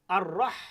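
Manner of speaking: রাহ ending on a hard pressed throat h; Arabic throat h; throaty h. Shown as a demonstration. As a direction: squeeze out the h of রাহ. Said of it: "হাটা কিভাবে উচ্চারণ হবে".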